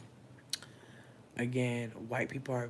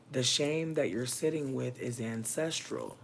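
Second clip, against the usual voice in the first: ordinary talk, nothing marked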